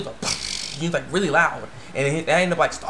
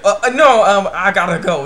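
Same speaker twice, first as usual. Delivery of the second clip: loud, higher mock panic; acted fluster; 4 semitones higher, 8 dB louder than usual. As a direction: stammer out an awkward excuse, hammed-up. Boy flustered by suggestive buns.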